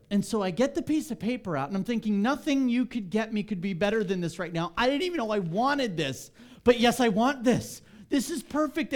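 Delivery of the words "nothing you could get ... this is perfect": higher and louder, building joy; thrilled. Retold or imitated. Man opening his favorite coffee, mounting excitement. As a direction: let the delight rise to the last line.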